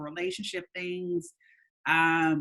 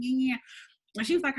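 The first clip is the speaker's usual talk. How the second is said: ordinary delivery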